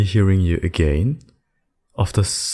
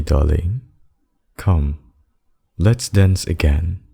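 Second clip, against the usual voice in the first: usual speaking voice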